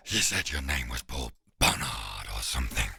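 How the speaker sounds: very low confused dog voice; deep beast, baffled and scared; whining hound. Acted. Frightened hound questions the swordsman, deep whine. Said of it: "he whines".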